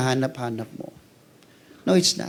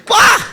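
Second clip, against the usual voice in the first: shocked wah; 10 dB louder than usual. Retold or imitated. Crowd gasping at Lazarus.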